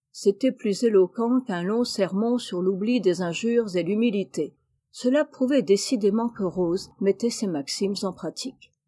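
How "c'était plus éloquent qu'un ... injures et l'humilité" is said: usual speaking voice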